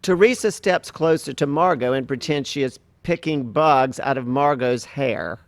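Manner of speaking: normal talking voice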